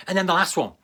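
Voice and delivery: ordinary talk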